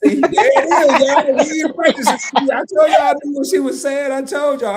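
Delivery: high, loud and laughing with triumph; excited. Real, not acted. Host cheering as Keisha says the phrase, excited shout. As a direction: crow about getting it right.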